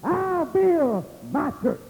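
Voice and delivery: high, drawn-out proclamation; slow, emphatic pulpit cry; preaching. Acted. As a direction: preach it high, stretching the big phrases. Preaches at full force.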